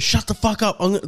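high mock-angry cut-off; imitated angry snap. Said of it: "Maz is getting fully angry".

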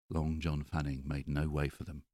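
plain reading voice

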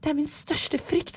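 high, soft, drawn-out mock dread. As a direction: voice your worst fear in a small high voice. Quotes her own dread. (påtatt gru, lyst og svakt)

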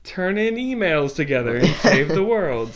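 high playful Thor voice; a light, chummy superhero; mock Thor voice.

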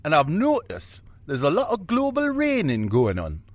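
high Geordie-style rock star voice. Retold or imitated. Rock star voice mocking global raining talk. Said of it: "The old Geordie".

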